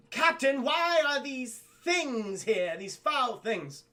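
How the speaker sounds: high, loud, drawn-out royal outrage; slow, disgusted princess voice; imperious princess. Acted. Princess demanding answers of her captain, haughty disgust. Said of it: "and she goes".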